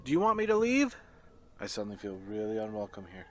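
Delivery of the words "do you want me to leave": loud, pitched-up call. Real, not acted. Hunter asking the spirit in the dark, loud call. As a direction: call out to whatever is there.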